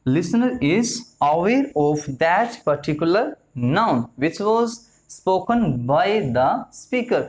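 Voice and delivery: each word laid out very slowly; very slow dictation. Shown as a demonstration. Teacher dictating English definition to students, very slow.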